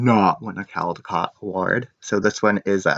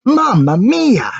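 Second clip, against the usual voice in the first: much higher, louder, slow Italian dismay; dismayed exclamation; 13 semitones higher, 7 dB louder, slower than usual. Acted. Big Anthony realizing he forgot the dough, loud and dismayed. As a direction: gasp it out, horrified at the mistake.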